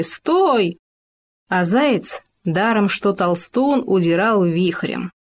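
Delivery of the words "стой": high yelling after a runaway; shouting stop. A narrator's reading. Hamster and gopher chase the hare, yelling high. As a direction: holler after him to stop. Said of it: "кричали".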